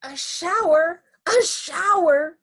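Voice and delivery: high, loud, stretched-out squeal; screaming children. A narrator's reading. Four children jumping into the shower, high excited screaming. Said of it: "screamed Missus Ralph's four children".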